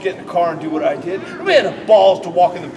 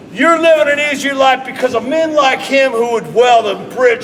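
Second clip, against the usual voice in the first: shout; 5 semitones higher, 4 dB louder than usual. Shown as a demonstration. Promo demo building to shout.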